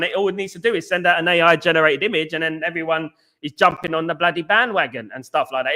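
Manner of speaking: ordinary talk, nothing marked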